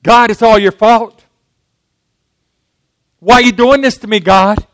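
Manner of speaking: a mild mock accusation of God; blaming God. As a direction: throw the blame at heaven. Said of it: "we say".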